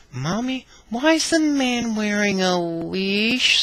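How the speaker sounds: put-on high, slow small-child voice; little girl. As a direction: ask like a curious little girl, drawn out. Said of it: "says the little girl".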